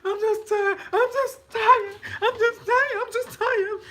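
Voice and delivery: high, loud hammed-up wailing; mock crying. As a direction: wail it like an overdone crybaby. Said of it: "You ever seen somebody crying".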